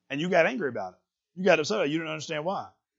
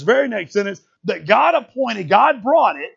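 slower, louder, higher, building to the wind; preacher building drama to God's east wind; building climax; 5 semitones higher, 6 dB louder, slower than usual. Acted. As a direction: build it up word by word.

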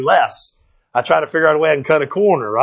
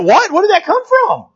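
higher, startled double take; taken aback; 14 semitones higher than usual. Retold or imitated. Dad startled by his kid's truck question, high and surprised. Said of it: "You go".

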